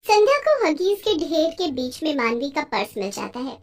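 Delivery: ordinary delivery, nothing marked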